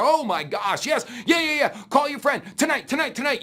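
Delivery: hammed-up giddy recruit; excited shout. Recruit tells coworker to call his friend tonight, excited shout. Back at top of roller coaster.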